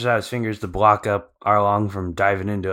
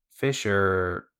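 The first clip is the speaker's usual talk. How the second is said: ordinary delivery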